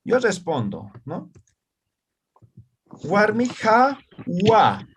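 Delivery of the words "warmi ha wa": slow model phrase. Shown as a demonstration. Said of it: "¿Qué estoy diciendo?".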